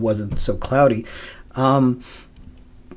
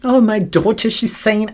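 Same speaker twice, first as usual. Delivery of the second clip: mocking mother; 8 semitones higher, 3 dB louder than usual. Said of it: "making fun of her own daughter".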